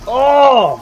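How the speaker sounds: sudden loud "Oh!"; startled yell. Real, not acted. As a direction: shout in shock. Sudden hit in game.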